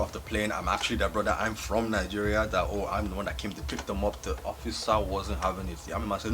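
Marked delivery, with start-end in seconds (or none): none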